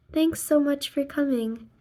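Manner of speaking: plain reading voice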